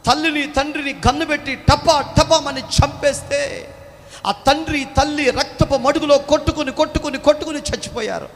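high, loud, grieving retelling; nurse's anguished account. Acted. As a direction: pour out the massacre story in anguish. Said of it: "అప్పుడు చెప్పింది".

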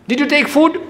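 high, rising caring ask; wife's caring question. Retold or imitated. Wife asking her husband if he ate, high and caring. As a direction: pitch it up like a fond wife.

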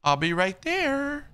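high, drawn-out playful call. Pretend. Delivery man answering a game character, high drawn-out call. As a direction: call out slowly, pitch climbing high.

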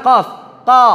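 qa with mouth as open as isti'la; teacher showing open qaf. Shown as a demonstration. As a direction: open fully on qa. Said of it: "membuka sama seperti isti'la".

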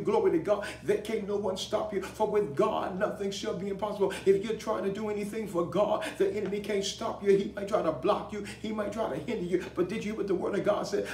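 impassioned rallying of the congregation; full-force preaching. Real, not acted. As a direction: preach it hard, punching each phrase.

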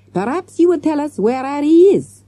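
put-on high, pointed French-accented demand; French detective. A narrator's reading. Detective questioning silent teacher, pointed. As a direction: press the suspect with pointed politeness.

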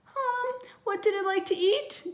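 put-on high, timid little boy; shaky voice. A narrator's reading. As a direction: ask it nervously, dreading the answer. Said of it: "Timmy asked in a shaky voice".

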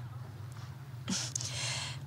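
low-volume, voiceless chuckle; soft laugh. Acted. Laughing at talk of detectives, a soft chuckle. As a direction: let out a quiet breath of laughter.

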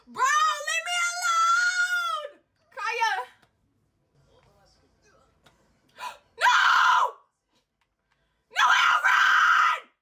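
very high, loud, slow desperate shrieks; crying-out terrified gamer; panicked screaming. Real, not acted. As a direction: scream for your life at the game. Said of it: "I scream like I'm being actually real life murdered".

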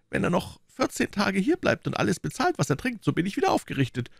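high landlord voice talking to himself; muttering innkeeper. A narrator's reading. Innkeeper privately counting on his guest's money, high and hopeful. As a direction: reckon it up to yourself, pitched high.

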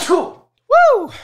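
a huge sneeze that bursts out as a high, loud "Woo!". Real, not acted. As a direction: let the held-back sneeze explode into a shouted whoop. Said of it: "that was a little too loud for".

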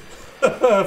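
loud, high relieved laugh; relieved laughter. Real, not acted. Laugh after the escape.

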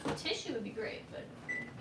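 quiet, breathy words through onion tears; tearing up and talking softly; teary from chopping onions. Real, not acted. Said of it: "and I'm crying".

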